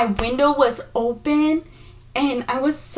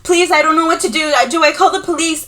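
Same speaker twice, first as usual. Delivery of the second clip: higher, louder retold panic; frantic pleading; 5 semitones higher, 4 dB louder than usual. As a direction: plead as if at a loss. Retold frantic plea during nightmare.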